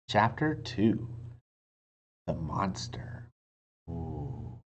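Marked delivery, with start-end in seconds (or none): 2.3-3.3 low, soft eerie whisper; spooky title. A narrator's reading. Reading a chapter title to children, low spooky whisper. 3.9-4.6 spooky ooh. Shown as a demonstration.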